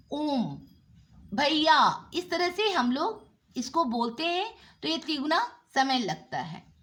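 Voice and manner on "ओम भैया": ओम, भैया stretched to triple length; prolonged vowel. Shown as a demonstration. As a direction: draw out ओम and भैया three times long. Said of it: "तीन गुना समय लगता है". (ओम और भैया को तिगुना खींचकर बोलें)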